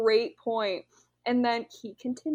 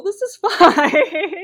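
laughing denial; 7 semitones higher, 11 dB louder, much slower than usual. Retold or imitated. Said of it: "where you're like".

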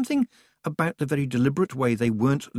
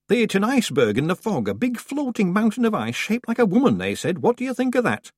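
high, eager gossiping villager; village woman; 12 semitones higher, 5 dB louder than usual. A narrator's reading. Village woman sharing the shipwreck news, high and eager. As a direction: chatter it out like a country gossip.

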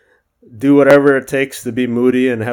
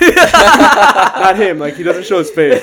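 laughing; 7 semitones higher, 7 dB louder, slower than usual. Real, not acted. Laughing at own joke.

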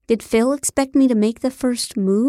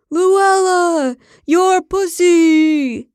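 high, loud, long-drawn mock-ecstatic cry; screamed out; 8 semitones higher, 6 dB louder, much slower than usual. A narrator's reading. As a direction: scream it in hammed-up passion, stretching each word. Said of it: "He screamed out".

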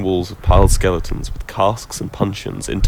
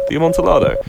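mocking echo; 8 semitones higher, 5 dB louder, faster than usual. A narrator's reading. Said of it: "I replied".

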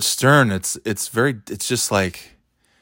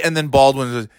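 normal talking voice

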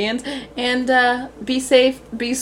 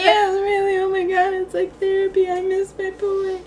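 sing-song whine; 12 semitones higher than usual. Retold or imitated. Whiny sing-song reply to dad.

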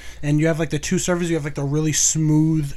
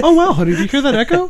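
mock sweet teasing; 9 semitones higher, 4 dB louder, faster than usual.

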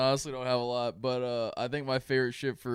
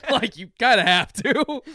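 laughing; 10 semitones higher, 8 dB louder than usual. Real, not acted.

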